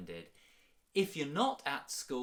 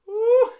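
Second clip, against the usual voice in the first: high loud laughing whoop; breathless woo; 19 dB louder than usual. Real, not acted. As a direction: let out a winded whoop. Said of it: "got me a little bit out of breath".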